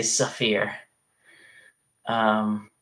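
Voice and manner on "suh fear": "Saphir" with an extra uh between s and f; kid's version of sphere, "Saphir"; childhood mispronunciation. Shown as a demonstration. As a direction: say "Saphir" in two syllables, suh-FEER. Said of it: "would say".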